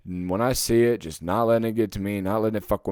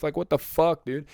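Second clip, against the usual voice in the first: normal talking voice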